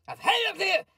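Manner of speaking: high, fed-up yell; exasperated shout. Pretend. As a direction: throw up your hands and yell it. Mock Pelosi ranting.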